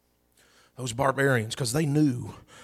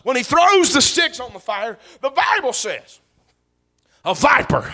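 high, loud, fervent preacher's cry; loud revival preaching; preaching at full force; 7 semitones higher, 7 dB louder than usual. Acted. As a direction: preach it out to the congregation. Preaching the viper story.

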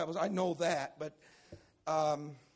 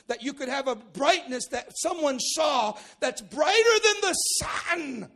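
shouting high and loud, slowing on the sun; preacher's shout; 8 semitones higher, 9 dB louder than usual. Real, not acted. Preacher stirring the congregation, loud shout. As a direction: build to a full preaching shout.

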